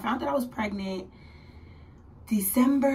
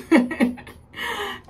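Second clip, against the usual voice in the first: high, loud burst of laughter; real laugh; 11 semitones higher, 6 dB louder than usual. Real, not acted. Vlogger telling of her missed period, real laugh. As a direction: crack up mid-story.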